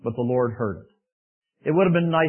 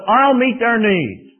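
very slow, louder, higher; voice of the Lord; 7 semitones higher, 8 dB louder, much slower than usual. Acted. The Lord promising to provide, very slow. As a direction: draw out each word as a promise. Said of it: "But the Lord says".

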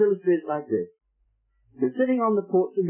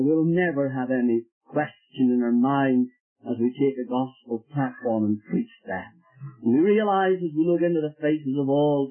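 low, slow, reverent prayer; 6 semitones lower than usual. Real, not acted. Preacher praying for sinners at the close, slow and reverent.

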